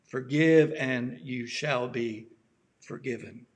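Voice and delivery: usual speaking voice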